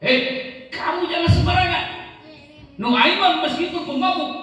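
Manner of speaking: high-pitched, forceful reprimand; stern rebuke. Acted. The Prophet rebukes the angry companion, stern rebuke. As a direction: cut him off with a high, indignant scolding. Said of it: "beliau memarahi sahabat yang marah".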